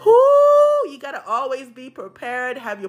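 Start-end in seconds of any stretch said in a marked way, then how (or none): 0.0-0.9 long, loud, very high "whoo"; exasperated whoop. Real, not acted. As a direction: let out a piercing, drawn-out whoo. Exclamation at draining energy.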